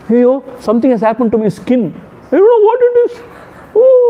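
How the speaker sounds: high, fast alarmed cry; mock panic. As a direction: squeal in sudden mock panic. Mimicking panicked person.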